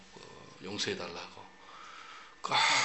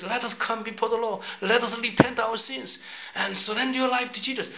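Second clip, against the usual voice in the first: street preaching; 8 semitones higher, much slower than usual. Retold or imitated. Preacher on a plane, preaching hard to passengers. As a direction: preach it at full force to the whole plane. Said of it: "설교를 더 강하게 했어요".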